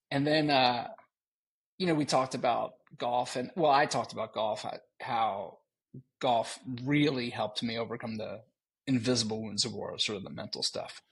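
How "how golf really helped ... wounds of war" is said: ordinary talk, nothing marked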